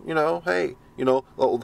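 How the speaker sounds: ordinary talk, nothing marked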